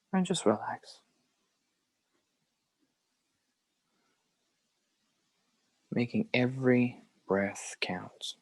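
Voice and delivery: unhurried phrases with very long silences; guided meditation. Acted. Teacher leading a group through belly breathing, guided meditation. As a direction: guide each breath, leaving room to follow.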